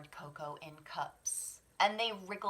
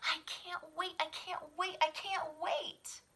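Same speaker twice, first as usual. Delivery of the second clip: eager cub; 10 semitones higher than usual. Acted. Child bear eager for cakes.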